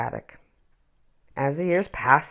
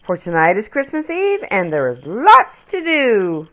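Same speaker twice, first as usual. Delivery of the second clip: merry mother; 5 semitones higher, 5 dB louder than usual. Acted. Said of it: "she said merrily".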